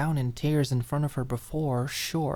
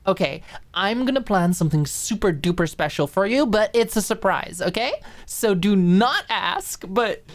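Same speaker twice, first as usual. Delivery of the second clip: higher and louder, bubbly surprise plan; excited promise; 6 semitones higher, 6 dB louder than usual. Acted. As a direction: hype up a super special secret. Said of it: "Maud smiled".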